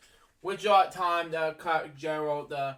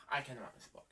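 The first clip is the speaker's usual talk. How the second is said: exasperated sigh; 3 semitones lower, 12 dB quieter, much faster than usual. Real, not acted. Frustrated at repeated misreading.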